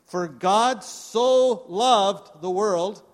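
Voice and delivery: very slow, loud opening of the verse; scripture reading. Acted. Pastor leading church in John three sixteen, very slow. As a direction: draw out every word for the church to join.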